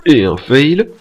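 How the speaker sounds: hyped gamer calling his own play; excited shout. Real, not acted. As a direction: call it out like a highlight. Gamer cheering own clip.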